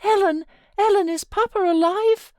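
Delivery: high, loud, quick sobbing cry; a girl sobbing out her question, frantic; sobbing plea. Acted.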